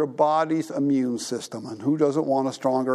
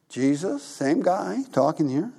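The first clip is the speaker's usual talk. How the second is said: ordinary delivery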